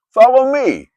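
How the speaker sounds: high, loud summons; commanding call. Acted. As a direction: call out a firm invitation. Jesus calls Matthew.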